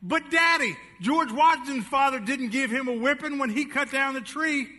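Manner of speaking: put-on a boy arguing his case; protesting boy.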